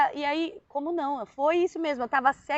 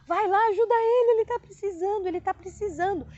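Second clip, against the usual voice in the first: high, pleading appeal for someone else; urgent pleading; 5 semitones higher than usual. Retold or imitated. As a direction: re-enact begging a helper to go, pitched up. Retells urging helper to act. (súplica urgente, voz aguda)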